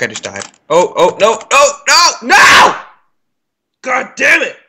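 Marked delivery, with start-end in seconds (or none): 0.7-2.8 no, no, no climbing higher and louder; real dismay building to a yell; rising panic yell. Real, not acted. Gamer watching his mouse die, panicked rising yell. 3.8-4.6 frustrated curse. Real, not acted. Frustrated after dying.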